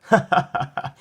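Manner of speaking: put-on giggling little boy; child laugh. Boy running with toy dog, giggling. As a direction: giggle while running around. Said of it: "Caillou laughed".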